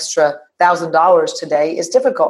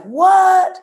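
surprised; 13 semitones higher, 4 dB louder than usual. Retold or imitated. Incredulous reaction in retelling.

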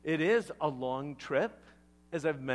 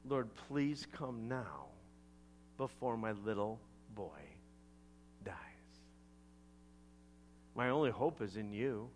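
pleading; 7 semitones lower, 8 dB quieter than usual. Acted. Father begs before his little boy dies, quiet pleading. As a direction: beg quietly, pausing between phrases. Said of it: "The official pleaded".

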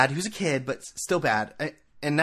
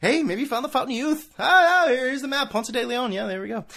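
mock explorer; 10 semitones higher, 3 dB louder than usual. Mocking Verlander's fountain of youth.

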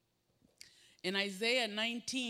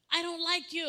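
put-on frustrated kid; 9 semitones higher, 7 dB louder than usual. Frustrated kid quoted to parent.

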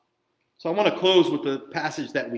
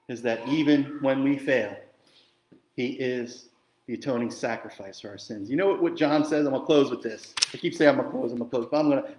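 ordinary delivery

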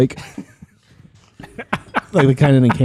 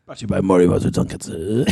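put-on funny voice; silly ad voice; 6 semitones lower, 5 dB quieter than usual. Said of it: "You're not allowed to do that".

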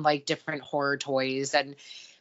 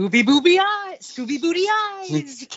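high, loud mock Scooby-Doo voice; 11 semitones higher, 4 dB louder than usual. Mocking the knockoff toy in a high Scooby voice. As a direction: ham up a goofy Scooby impression.

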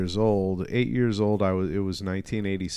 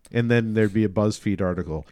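usual speaking voice

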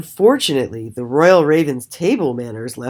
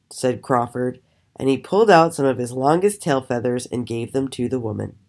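usual speaking voice

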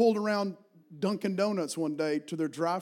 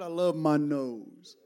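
lightly imitated Arabic accent, slow and low; Arabic speaker; 4 semitones lower, much slower than usual. Preacher imitates an Arabic-speaking minister, slow Arabic accent. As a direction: draw it out in a mild Arabic accent. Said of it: "he was an Arabic speaker".

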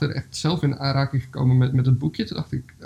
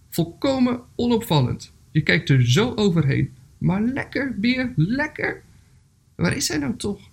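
high, lilting ant reply; calling back; 7 semitones higher, slower than usual. Acted. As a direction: lightly call back on a high pitch. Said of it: "riep de mier terug".

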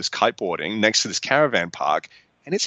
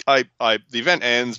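usual speaking voice

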